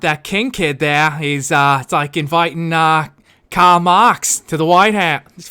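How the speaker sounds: a Boston JFK slipping into Australian; JFK impression in an Australian accent. Redoing the Kennedy quote, accent drifting Australian. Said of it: "I keep, like, going into Australian".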